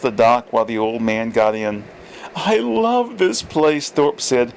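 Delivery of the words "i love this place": put-on old man. A narrator's reading. Old Thorpe voiced.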